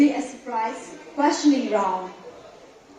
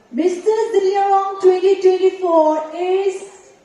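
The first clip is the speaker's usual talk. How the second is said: pageant announcer; 7 semitones higher, 8 dB louder than usual. Acted. Announces Mister winner.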